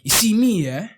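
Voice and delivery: lightly put-on London roadman accent. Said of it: "who's from London".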